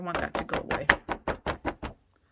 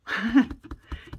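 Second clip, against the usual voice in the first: a quick real laugh at herself; light laugh; 4 semitones higher, 6 dB louder than usual. Real, not acted. Crafter laughing at her own mistakes, light laugh.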